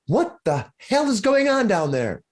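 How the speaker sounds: high, distant call down the hall. Acted. Innkeeper Lenine calling down the hall at noise, high call. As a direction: call out from far off, indignant. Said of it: "calling out".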